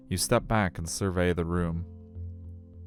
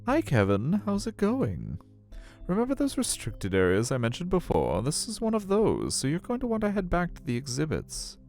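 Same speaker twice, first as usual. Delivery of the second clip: sing-song high staff voice; aquarium staffer; 11 semitones higher than usual. Acted. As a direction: put on a high voice for an official politely shooing you away. Acts out aquarium staff member speaking.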